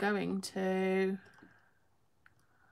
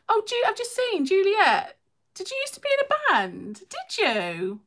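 much higher, thrilled question; surprised, asking a friend in chat about her band; delighted surprise; 10 semitones higher, 5 dB louder than usual. Real, not acted. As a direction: squeal it out, amazed at the news. Just saw Juliet was in band.

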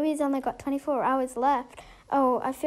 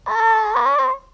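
sudden high panicked shriek; short loud cry of fright; panicked scream; 8 semitones higher, 7 dB louder than usual. Pretend. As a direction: scream in alarm at the emergency. Pretend emergency, friend not breathing.

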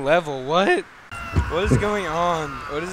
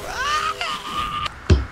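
high, sharp panicked yelp; panicked scream. Real, not acted. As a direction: shriek in sudden fright. Under fire in game.

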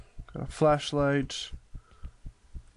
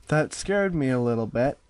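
normal talking voice

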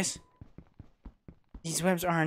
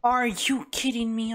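ordinary talk

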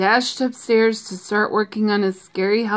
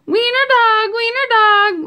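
high, loud playground chant; gleeful taunting; 13 semitones higher, 5 dB louder than usual. A narrator's reading. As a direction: jeer it like a teasing pack. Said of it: "laughed the other dogs".

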